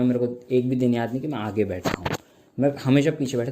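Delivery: ordinary talk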